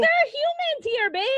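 teasing high voice. Pretend.

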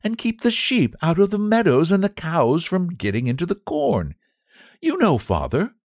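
put-on high, light young woman's voice; a kind young lady explaining; young lady, pleasant. Daughter explaining the boy's duties, pleasant.

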